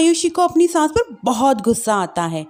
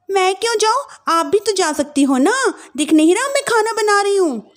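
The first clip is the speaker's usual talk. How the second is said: higher, huffy dismissive bride; sulky young wife; 8 semitones higher than usual. Acted. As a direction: storm off in a huff. Wife refuses.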